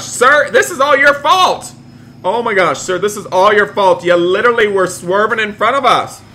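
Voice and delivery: loud, high-pitched accusation; indignant shouting. Pretend. Driver blames the sir who swerved, indignant shouting. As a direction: berate the other driver in play outrage.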